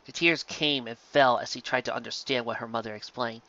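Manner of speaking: ordinary delivery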